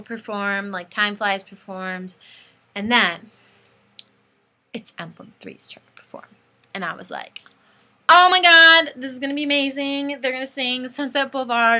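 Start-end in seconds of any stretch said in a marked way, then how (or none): 4.7-6.3 whispered, lower reveal; hushed suspense. Real, not acted. Fan announcing her band is up next, hushed suspense. Builds suspense before band's set. 8.1-11.8 high, loud outburst easing as it goes; acted fangirl excitement. Fan about to see her band perform, bursting with excitement.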